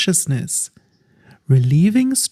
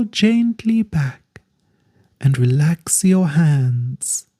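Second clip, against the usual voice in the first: calm guided relaxation voice drawing out each instruction; guided meditation. Acted.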